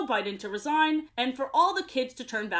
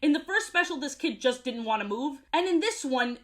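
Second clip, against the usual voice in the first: ordinary delivery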